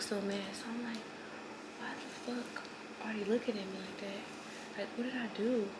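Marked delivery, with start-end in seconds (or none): 1.8-5.7 very soft, paranoid inner voice; retold bewildered thought, barely voiced; hushed disbelief. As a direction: say it under your breath, baffled. Retelling her stunned, paranoid thought.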